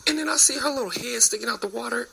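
normal talking voice

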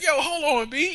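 high, incredulous stop; hold on. Retold or imitated. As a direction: pull him up in disbelief. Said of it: "I'm like".

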